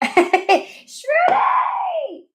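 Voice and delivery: high, delighted shout with a laugh; excited laughing shout. Real, not acted. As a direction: call the name out with a burst of laughter. Greeting a viewer by name.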